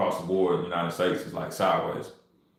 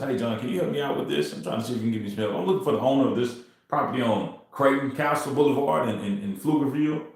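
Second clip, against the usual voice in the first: casual ask for help; 5 semitones higher than usual. Shown as a demonstration. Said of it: "Now the approach is".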